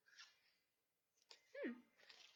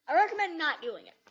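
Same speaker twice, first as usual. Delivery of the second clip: loud warning; 5 semitones higher, 15 dB louder than usual. Real, not acted. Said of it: "mock warning to viewers".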